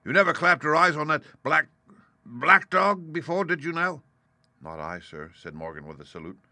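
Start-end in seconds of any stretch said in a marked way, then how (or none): 0.0-4.0 high, stern cross-examination. A narrator's reading. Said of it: "said Long John very sternly". 4.6-5.3 low, soft meek denial; sheepish. A narrator's reading. Old sailor denying to landlord, sheepish. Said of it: "pretty sheepishly".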